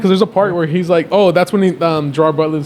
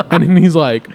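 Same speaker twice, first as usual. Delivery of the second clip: ordinary talk, nothing marked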